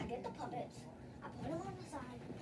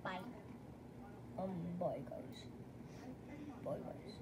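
low, deep voice; deep pitch shift; 8 semitones lower, 11 dB quieter than usual. Real, not acted. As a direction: voice dropped very low. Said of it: "Why is my voice so low?".

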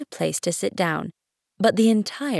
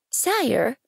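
put-on higher, polite child character; respectful little prince; 7 semitones higher than usual. A narrator's reading. Prince approaching the king with a question, respectful. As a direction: address the monarch meekly.

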